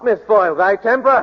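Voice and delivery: shocked Quaker uncle's reproof; stern rebuke. Acted. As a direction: reprimand her sharply for her temper. Uncle scolds Kitty in quarrel.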